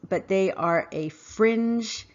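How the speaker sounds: slow, leaning hard on "fringe"; stress on fringe. Real, not acted. Commentator stressing to listeners that they are a "fringe", slowly. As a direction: land the word "fringe" with weight, slowly. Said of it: "Let me emphasize that word".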